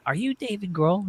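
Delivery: high-pitched imitation of a little kid. Kid approaches rock star on the beach, high imitated child voice. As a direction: put on a high kid voice for the retelling. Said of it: "this little kid comes up to me".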